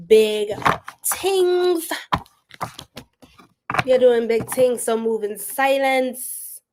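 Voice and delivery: a hint of a bad Jamaican accent, slow; hammed-up Jamaican patois, dragged out; horrible Jamaican accent. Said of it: "This is a horrible accent".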